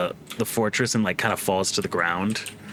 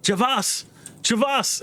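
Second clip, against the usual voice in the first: higher-pitched frantic woman's call; 12 semitones higher, slower than usual. Acted. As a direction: call out for a missing companion, pitched high. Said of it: "the woman basically like".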